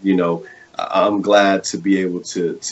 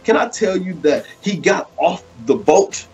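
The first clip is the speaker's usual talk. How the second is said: higher-pitched, rolling sermon cadence; fervent preaching; 8 semitones higher than usual. Real, not acted. Preacher challenging viewers to get off the boat, fervent preaching. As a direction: preach it with rising urgency, punching the key words. Preaches the wisdom stone message.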